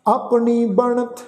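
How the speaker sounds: slow, measured Gurbani reading; scripture recitation. Shown as a demonstration. Teacher recites verse for congregation, slow sustained recitation. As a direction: draw out the line endings, pausing between phrases.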